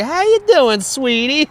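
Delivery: very high, put-on woman's voice; mock-flirt in falsetto; hammed-up high-pitched come-on; imitated flirty woman.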